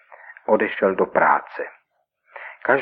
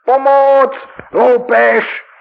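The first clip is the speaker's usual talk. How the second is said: much higher, much louder scream for help; panicked cry; 10 dB louder, much slower than usual. Acted. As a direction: shriek it in terror. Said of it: "vykřikl". (zděšený křik o pomoc, mnohem výš a hlasitěji)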